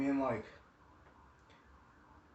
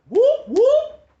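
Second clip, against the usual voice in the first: loud, very high rising whoops; police siren whoop; 15 dB louder than usual. Retold or imitated. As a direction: mimic a squad car's siren burst. Imitates police siren behind them.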